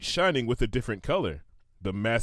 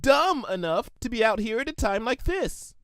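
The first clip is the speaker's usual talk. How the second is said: high sheepish pony voice; 10 semitones higher than usual. Acted. Shimmer correcting herself.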